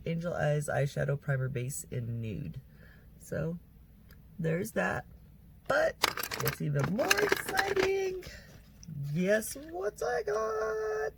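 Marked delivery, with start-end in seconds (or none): 5.7-11.1 high, slow, excited teasing voice. Real, not acted. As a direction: build up the surprise, slowly. Teasing the new palette reveal.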